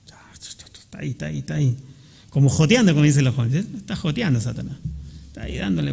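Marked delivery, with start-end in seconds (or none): 0.0-1.8 whispered taunt of Satan at the ear; susurro insidioso. Acted. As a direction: whisper it, dragged out at first, like a pestering tempter. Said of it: "Como hablándonos, como merondeando". (susurro de Satanás al oído)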